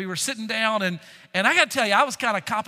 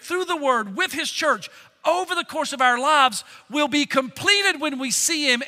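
pitched up and louder, driving each phrase home; full-force preaching; 6 semitones higher, 5 dB louder than usual. Acted. Pastor preaching to the church at full force. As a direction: preach it out to the congregation.

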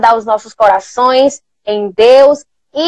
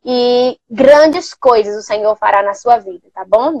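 normal talking voice

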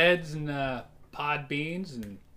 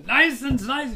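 higher, louder, drawn-out fake German accent; mock German accent; 10 semitones higher, 7 dB louder, slower than usual. Host teases co-host's accent attempt with a mock German accent. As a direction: imitate a bad German accent, slowly. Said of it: "You're just doing".

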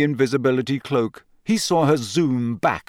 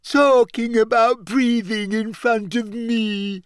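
high, drawn-out ghostly wail; shrill aggrieved whine; 9 semitones higher than usual. A narrator's reading. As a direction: wail it in offended self-pity. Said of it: "she said shrilly".

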